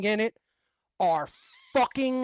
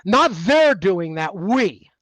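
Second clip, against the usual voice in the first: "they're" and "we" set against each other, "we" punched louder; stress on we; 6 dB louder, slower than usual. Shown as a demonstration. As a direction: hit "we" hard after "they're". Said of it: "is the term that's used".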